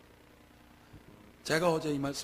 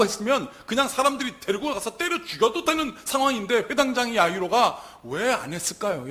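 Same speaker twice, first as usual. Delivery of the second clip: loud, raised preaching voice; impassioned preaching; 9 semitones higher, 12 dB louder than usual. Real, not acted. Preacher asking why the ruler held back, impassioned. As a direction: press the question on the congregation.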